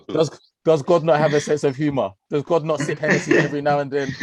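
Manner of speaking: high, loud, mock-outraged question; hammed-up protest to the heavens; laughing disbelief. Real, not acted. Fan joking about God sipping Hennessy, high mock outrage.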